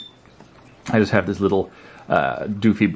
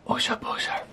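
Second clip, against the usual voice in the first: whisper; 9 dB quieter than usual. Real, not acted. Whispered name to the pet.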